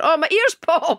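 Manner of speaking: high, slow, breathy mock ecstasy; ecstatic gasp. [name] describing her ear popping, mock ecstatic. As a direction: savour it like pure bliss. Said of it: "You go".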